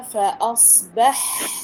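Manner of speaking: slow "فأصبح" with the final ح let run on as breath; long airy ح ending "فأصبح"; flowing ha demonstration. Shown as a demonstration. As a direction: draw out the ح of "فأصبح", sound flowing freely. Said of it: "يعني جريان الصوت".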